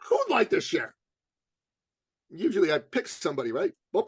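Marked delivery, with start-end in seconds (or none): 0.1-1.0 high, chirpy invitation; calling out to the room. Retold or imitated. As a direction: ask it high and bright to a whole room. Calls out to workshop audience.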